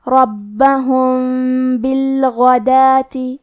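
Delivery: ربہم بالغداتی with م hidden into ب in a nasal hum; slow nasal ikhfa shafawi; hidden meem with ghunnah. Shown as a demonstration. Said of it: "میم ساکن کو چھپاتے ہوئے اور غنہ کے ساتھ".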